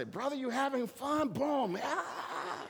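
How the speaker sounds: high, loud, laughing fan voice; excited fan. Retold or imitated. Preacher imitating the cheering fan beside him, high and gleeful. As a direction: play the overjoyed woman in the stands. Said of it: "turn around and say".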